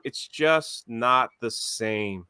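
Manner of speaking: slow, soft, mock-heartbroken; sad. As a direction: sigh it out like a loss. Mourning his lost game progress.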